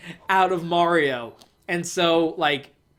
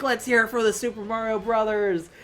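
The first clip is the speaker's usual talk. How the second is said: higher-pitched crowd-rallying call; Spike from Wrecking Crew; 5 semitones higher than usual. Retold or imitated. Spike cheering on the brothers, mock rallying call.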